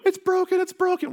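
put-on high, loud panicked kid yell; screaming kids. Kids screaming to dad, high panicked yell. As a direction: wail it out like a scared boy. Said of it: "We're screaming".

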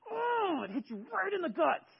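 high, pained cry of shock; sudden hurt outcry; pained exclamation. Retold or imitated. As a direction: yelp it like it stings. Imagined gut reaction quote.

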